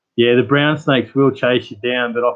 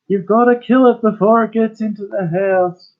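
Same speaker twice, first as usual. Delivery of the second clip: high-pitched, a bit louder, hammed-up panic; imitating a neighbour in hysterics over a snake; mock hysterical scream; 11 semitones higher, 3 dB louder than usual. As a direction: shriek it like the terrified neighbour. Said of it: "erupted into screaming hysteria".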